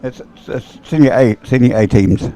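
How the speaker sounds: usual speaking voice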